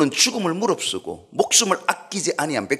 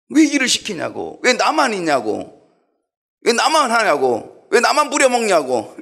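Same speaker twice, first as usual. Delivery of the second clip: whiny mock protest, pitched high and louder; mock complaining; 7 semitones higher, 4 dB louder than usual. Church member grumbles about being given work, mock complaining. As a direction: imitate grumblers with long, high, falling complaints.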